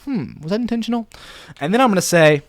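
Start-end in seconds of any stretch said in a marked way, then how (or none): 0.0-1.1 wondering listener. Acted. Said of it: "someone to be like".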